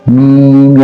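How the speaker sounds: मीम with a very long, even ee; elongated madd. Shown as a demonstration. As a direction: stretch the ee of मीम steadily. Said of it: "मद होती है इसके ऊपर".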